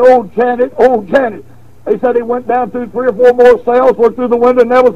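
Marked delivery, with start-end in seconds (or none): none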